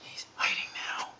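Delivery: whispering. Real, not acted. Sneaking up on a bird.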